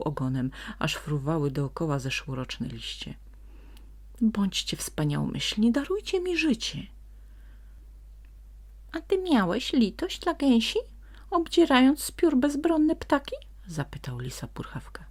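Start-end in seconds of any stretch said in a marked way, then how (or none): 4.2-6.8 pleading. A narrator's reading. Fox begs for life. 8.9-13.4 high, loud accusing sprite voice; indignant sprite. A narrator's reading. Sprite reproaches the fox about the geese.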